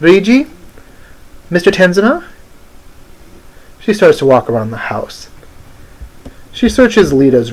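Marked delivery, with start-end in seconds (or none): 0.0-2.2 higher, questioning call. Acted. Odette searching for Reiji in the house, calling out. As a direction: call their names with rising pitch. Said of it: "She calls out".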